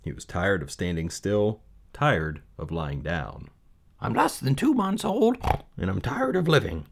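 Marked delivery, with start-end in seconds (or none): 4.0-6.9 high, gloomy piglet voice; young pig sighing about life; high-pitched weary little pig; Wilbur the pig. Acted. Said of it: "he said".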